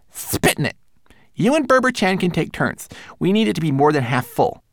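high little manservant voice; fussy tiny homunculus. Acted. Twigleg orders.

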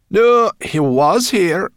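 high, loud, cheeky brownie voice; squeaky brownie. A narrator's reading. Brownie answering the dragon, high and cheeky. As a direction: pipe it up like a bold little brownie.

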